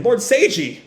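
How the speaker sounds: high acted call to a wounded man; alarmed cry. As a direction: cry out in mock distress.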